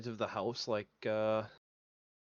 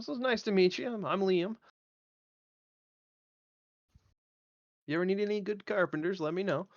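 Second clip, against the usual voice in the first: friendly carpenter; 7 semitones higher than usual. Acted. Player greets in character.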